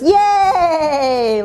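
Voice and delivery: high excited cheer; mock audience cheer; cheering crowd. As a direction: yell yay like a thrilled audience. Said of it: "everyone goes".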